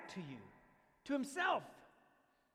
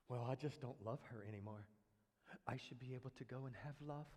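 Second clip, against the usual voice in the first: lower, softer mock excuse; mock selfish husband; 11 semitones lower, 17 dB quieter than usual. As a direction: play the self-pitying husband, lightly mocked. Mocking a man leaving family.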